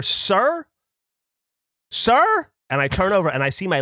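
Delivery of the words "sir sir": high, imitated call for attention; calling out sir. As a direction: call out to him across the room, pitched up. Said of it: "And then I hear".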